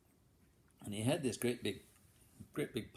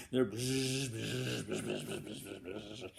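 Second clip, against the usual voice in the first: mosquito buzz; much slower than usual. Acted. Big mosquitoes buzzing.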